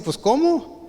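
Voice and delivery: high, puzzled "Pues, ¿cómo?"; baffled crew. Retold or imitated. Crew can't follow the shouted orders, high puzzled reply. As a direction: throw up your hands, confused. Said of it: "pues van a decir".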